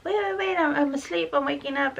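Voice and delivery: a half-hearted plea from someone just waking; imitating sleepy pleading. Daughter begging the nurse to wait, mock pleading. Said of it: "You tried to tell her, like".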